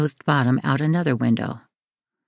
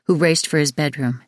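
ordinary delivery, nothing marked

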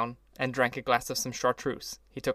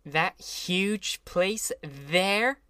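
slow, raised exclamation from Daisy; mild cry; 6 semitones higher, much slower than usual. A narrator's reading. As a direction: point and marvel, drawn out. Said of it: "she cried".

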